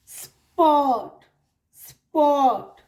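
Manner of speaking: "स्पॉट" for sport, slow, long aw and no r. Shown as a demonstration. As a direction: stretch "स्पॉट", loud vowel straight into t. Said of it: "यहाँ पर बीच में आया तो".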